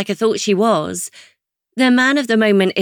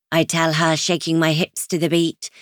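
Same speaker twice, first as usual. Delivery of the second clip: plain reading voice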